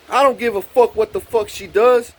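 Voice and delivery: high, loud mock-angry quote; defiant outburst. Next woman defying him before a slap, defiant. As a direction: imitate someone loudly refusing to care.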